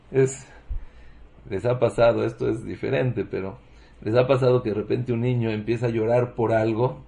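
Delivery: normal talking voice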